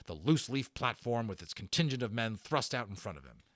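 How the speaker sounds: usual speaking voice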